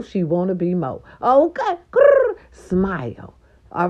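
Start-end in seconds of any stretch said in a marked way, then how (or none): 1.9-2.4 very high squeaky grrr; playful growl. Pretend. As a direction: growl like a flirty cat.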